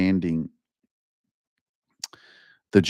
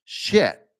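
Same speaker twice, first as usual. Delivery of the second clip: a startled, higher "Shit" as it dawns; sudden realization; 8 semitones higher than usual. Pretend. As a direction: catch yourself realizing it. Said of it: "inspires you to go".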